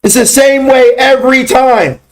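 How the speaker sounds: high, slow, forceful declaration; drill-instructor bark. Acted. Instructor drilling button setup into students, drill-sergeant bark. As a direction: hammer each word like a drill sergeant.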